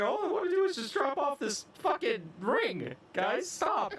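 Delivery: high-pitched whiny mock plea; exasperated Frodo. Friend acting Frodo on the ring quest, exasperated. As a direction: pitch it way up, hammed-up weary hobbit. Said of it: "just like Frodo walking in, he's just like".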